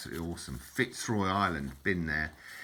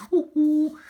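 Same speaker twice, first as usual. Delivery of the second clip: loud, very high "ooh-ooh" hoots; comic high whoop; 11 dB louder than usual. Acted. Man mocking his old brain going, high comic hooting. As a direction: whoop it like a brain gone haywire.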